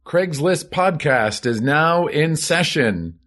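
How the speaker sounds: booming court crier, pitched high; mock bailiff calling court to order; town crier. Opens podcast as court bailiff.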